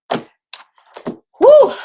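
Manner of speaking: loud, high exhaled whew; big high-pitched sigh of relief; exhausted whew. Real, not acted. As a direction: blow out a big whew after lots of talking. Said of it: "I have talked a lot this morning".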